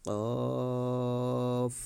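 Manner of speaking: কফ said full and short, never like কাফ; Arabic letter demo. Shown as a demonstration. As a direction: model কফ clearly apart from কাফ. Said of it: "একসাথে বলি". (কাফ থেকে আলাদা করে কফ উচ্চারণ)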